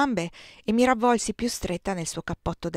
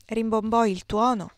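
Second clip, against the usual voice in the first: plain reading voice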